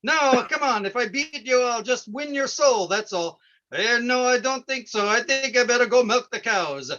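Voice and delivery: corny back-and-forth puppet voices, folksy; hammed-up farm puppet-show voices; felt puppet dialogue. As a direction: act out a silly puppet scene of casual wheedling and refusal.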